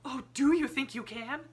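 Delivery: desperate Miss Amelia gasps at Sarah; hopeful gasp. A narrator's reading. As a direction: grab at the hope. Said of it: "she gasped".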